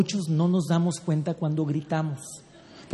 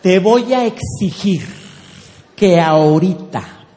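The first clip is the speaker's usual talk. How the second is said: loud, slow, drawn-out ultimatum; emphatic demand; 5 dB louder, slower than usual. Shown as a demonstration. Wife issuing ultimatum to husband, slow and forceful. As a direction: lay down the demand weightily, stretching words. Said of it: "pero tiémblele".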